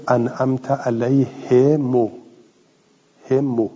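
"علیه مو" as "alayhe mo", then "همو" alone; damma on mim. Shown as a demonstration. Teacher citing another reader, "علیه مو" with rounded final vowel. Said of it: "و ضم المیم".

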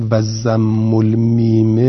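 drawn-out و ضم المیم; deliberate, stretched teaching voice; slow emphasis. Shown as a demonstration. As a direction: slowly stress the rare u-vowel reading. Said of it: "لغتون شاذه".

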